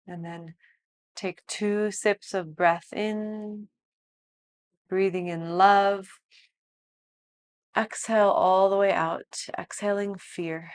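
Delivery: soft, slow meditation voice; guided meditation. Acted. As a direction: guide them gently into stillness. Leads breathwork and relaxation.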